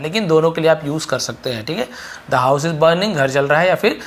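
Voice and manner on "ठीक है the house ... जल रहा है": usual speaking voice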